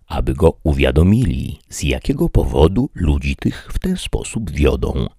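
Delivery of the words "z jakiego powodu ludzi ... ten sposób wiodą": plain reading voice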